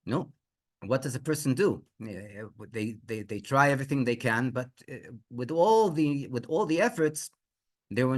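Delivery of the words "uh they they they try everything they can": ordinary delivery, nothing marked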